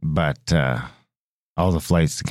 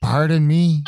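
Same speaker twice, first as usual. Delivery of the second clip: indignant pardon me; 8 semitones higher, slower than usual. Retold or imitated. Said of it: "And I'm like".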